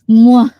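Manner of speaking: loud, high mock evil cackle; big playful villain laugh; emphasized witch laugh. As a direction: burst into a hammed-up "mwahaha". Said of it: "gonna emphasize the laugh for tonight".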